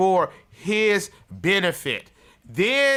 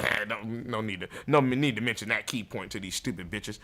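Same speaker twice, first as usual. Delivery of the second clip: sly aside; 6 semitones lower, 6 dB quieter than usual. Acted.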